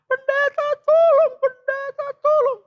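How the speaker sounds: very high, loud pleading; imitated panicked scream. Mother begs pastor to help child, imitated loud high scream. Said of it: "mamanya bingung teriak".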